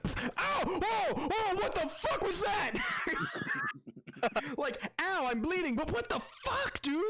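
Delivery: high, shouted screech of a wounded bird; wounded bird creature. Acted. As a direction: yelp like a bird just shot in the wing. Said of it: "He's like".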